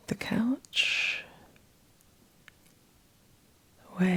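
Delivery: soft, breathy meditation voice with long pauses; guided meditation. Acted. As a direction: guide them gently back, phrase by phrase, leaving space. Guides client back into body.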